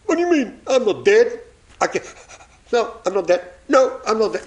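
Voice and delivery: high-pitched, mock protest; indignant denial. Congregant objects to being told he's dead, mock-indignant.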